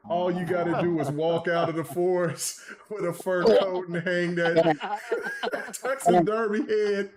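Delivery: amused read-aloud, breaking into laughs; laughing while reading. Real, not acted. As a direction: read it out through real laughter. Host cracks up reading chat.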